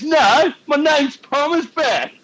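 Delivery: high, loud, slow mock protest; imitated indignant outburst. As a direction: mimic an affronted player, voice shooting up. Indignant teammate takes the bait.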